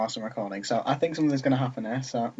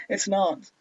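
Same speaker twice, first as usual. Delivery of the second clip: higher retake dropping the American vowel; attempted non-American accent; 7 semitones higher than usual. Retrying the line after slipping, avoiding the American accent. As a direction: correct the American "not" to British, pitched up. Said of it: "That's an American accent".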